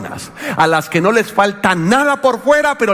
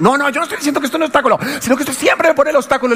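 heated protest; 9 semitones higher, much faster than usual. Retold or imitated. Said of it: "le dije".

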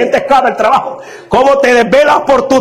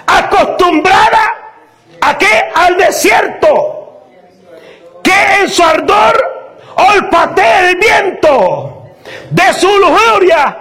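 raised, fervent scripture reading; preaching at full force; 7 semitones higher, slower than usual. Acted. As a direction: thunder the prophet's words at the congregation. (predicación a todo pulmón, con voz elevada)

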